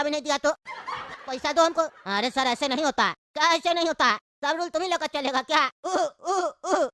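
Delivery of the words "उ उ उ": fake cough. Father coughs again.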